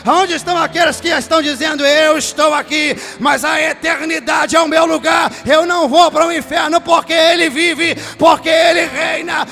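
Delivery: loud, high, half-sung revival preaching, shouting the climaxes; preaching at full force. Acted. Pastor to his congregation about being reached by grace, roaring sung preaching. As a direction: lift the church up and bellow the promise of grace.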